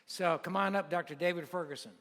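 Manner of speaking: announcer intro. Real, not acted. Preacher calling the guest up to the stage, announcer style.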